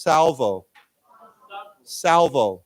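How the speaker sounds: SALVO said as a clear model word; model pronunciation. Shown as a demonstration. Teacher leads the class in saying this word first, model pronunciation. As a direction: say "salvo" alone for the class to repeat. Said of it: "let's say this word first".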